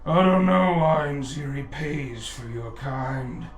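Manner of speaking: lightly voiced brute, unhurried threat; giant's sneer. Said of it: "he looks and says".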